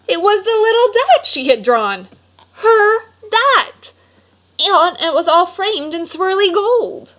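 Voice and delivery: storyteller gasping at the framed dot; amazed discovery. A narrator's reading. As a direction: reveal it like a big surprise.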